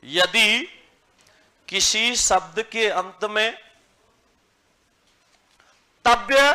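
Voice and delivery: slow, spaced words for students to copy; slow dictation. Shown as a demonstration. Teacher dictating a rule to the class, slow and clear. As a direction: dictate each word slowly. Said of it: "dictation continues slowly".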